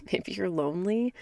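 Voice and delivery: giggling through the line; words breaking into a real laugh. Real, not acted. Podcast host to listeners, laughing.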